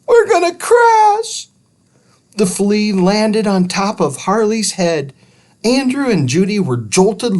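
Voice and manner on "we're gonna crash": very high, loud yell; panicked scream. Acted. Judy screams to Andrew before the crash. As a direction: shriek the warning. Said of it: "screamed Judy".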